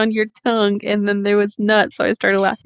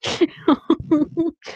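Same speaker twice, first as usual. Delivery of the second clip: a sudden high giggle; burst of laughter; 7 semitones higher than usual. Real, not acted. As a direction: break into laughter mid-read. Giggling at the fic reading.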